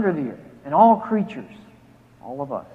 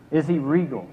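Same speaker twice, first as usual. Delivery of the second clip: usual speaking voice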